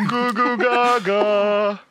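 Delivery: put-on high, slow baby babble. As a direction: coo it like a baby. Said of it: "That's him doing a sound check".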